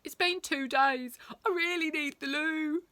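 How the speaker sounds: put-on desperate boy voice.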